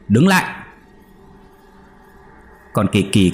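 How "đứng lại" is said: even higher, final rising shout; shouted order, pitched up. A narrator's reading. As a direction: yell it after the fleeing shadow. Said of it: "liền quát lên".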